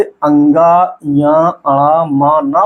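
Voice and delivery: slow, drawn-out अंगा, अणा; slow drill. Shown as a demonstration. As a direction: stretch each nasal out slowly. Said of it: "लेकिन यहीं पे".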